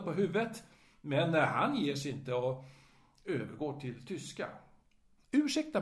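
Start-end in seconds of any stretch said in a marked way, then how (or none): 5.3-5.8 a hint of a fussy old gentleman, pitched up; lightly voiced courteous old man; old man's greeting. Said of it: "försöka imitera andras röster".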